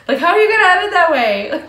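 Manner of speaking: loud, high, fast indignant yell; mad outburst. Real, not acted. Fan ranting about how a show ended, mad outburst. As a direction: shout it in exasperated disbelief. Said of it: "I'm a little mad".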